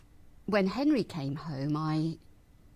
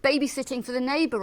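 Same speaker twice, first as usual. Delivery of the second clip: higher, louder, agitated recounting; flustered explaining; 5 semitones higher, 6 dB louder than usual. Acted. Widow recalls trying to explain the babysitting, flustered. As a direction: explain yourself in a raised, flustered voice.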